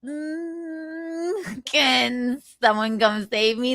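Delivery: whimpering plea for rescue, slow; hammed-up helpless whine; mock crying for help. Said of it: "he's like".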